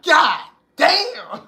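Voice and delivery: high stunned "God damn"; awestruck god. Retold or imitated. God seeing goddesses step off the ship, high awed exclaim. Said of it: "the God's gonna be like".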